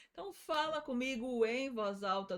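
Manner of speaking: normal talking voice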